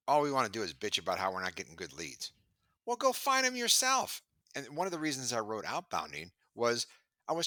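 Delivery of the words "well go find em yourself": high, sharp mock retort; a quick high-pitched jab. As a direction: snap it back in a high voice.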